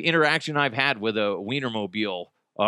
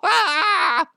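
creepy clown cackle; 6 dB louder than usual. Pretend.

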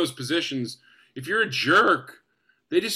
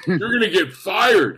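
louder, higher mock warning; teasing; 4 semitones higher, 6 dB louder than usual. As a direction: needle the newcomer with a laughing jab. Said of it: "he just started teasing me".

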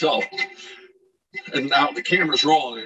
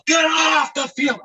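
yelling back; slower than usual. Retold or imitated. Napping catcher shouting the groundskeeper's order back, full shout. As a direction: echo the yell right back. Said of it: "I go".

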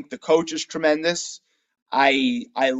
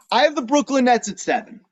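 higher-pitched, punchy declaration; bold announcement; 6 semitones higher than usual. Real, not acted. Podcaster revealing a surprise ranking to co-host, boldly announced. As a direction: drop the surprise pick, pitched up.

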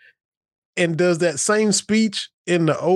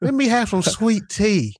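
mock Southern accent; 6 semitones higher than usual. Host mocking the coach's fake Southern accent. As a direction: ham up a fake Southern twang. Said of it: "I'm Brian Kelly".

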